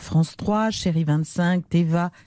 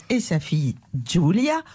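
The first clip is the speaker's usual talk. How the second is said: normal talking voice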